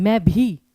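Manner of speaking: "भी" given force and focus; stress on भी. Shown as a demonstration. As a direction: press firmly on "भी", a tiny break with it. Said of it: "वहां पे pressure दिया जाता है". ("भी" पर ज़ोर देकर)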